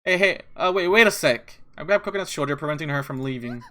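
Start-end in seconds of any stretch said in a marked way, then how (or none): 0.0-1.6 loud, higher urgent call; hurried, raised-voice plea to wait; urgent call to stop. Acted. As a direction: call after someone who is leaving. Stops character from leaving.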